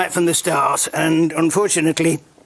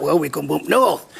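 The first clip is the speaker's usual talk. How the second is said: imitating a northern English accent; lightly mimicked northern accent; 2 semitones lower than usual. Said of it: "Can you do a northern accent?".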